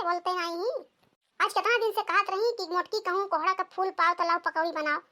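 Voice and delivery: ordinary talk, nothing marked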